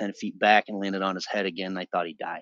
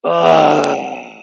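loud, drawn-out groan; heavy groan; 6 semitones higher, 10 dB louder than usual. Real, not acted. Narrator recalling the police arriving, loud groan. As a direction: groan it out, higher and louder.

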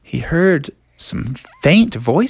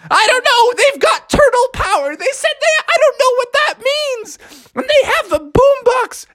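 very high frantic squeal; panicked frog; 3 dB louder than usual. Acted. Froggy argues with friends about the turtles, panicking. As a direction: babble in hammed-up alarm.

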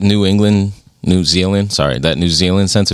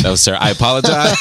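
ordinary delivery

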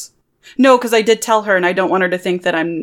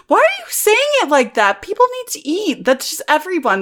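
high-pitched, flustered objection; defensive protest; 11 semitones higher than usual. Acted. Lou defends the sandwich gift, high and flustered. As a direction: object indignantly, pitch shooting up.

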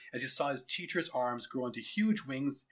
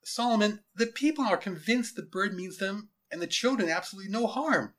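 higher, pleading appeal; earnest lieutenant; 7 semitones higher, 3 dB louder than usual. Acted. Lieutenant pleading with his general to spare the bird, earnest. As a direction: reason urgently with a superior.

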